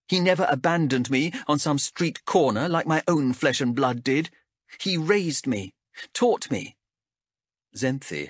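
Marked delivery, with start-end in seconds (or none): 0.1-6.8 lightly voiced guarded young ranger; a hint of a defensive wanderer; young woman character voice. Chev voiced by narrator.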